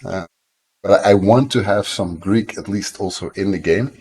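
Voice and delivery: ordinary delivery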